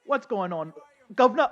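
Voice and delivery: put-on London accent, loud and high. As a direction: hail a mate in a London accent. Greeting in game, London bit.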